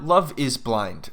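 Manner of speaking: slow, drawn-out show title; dramatic announcement. Acted. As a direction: announce it like a trailer.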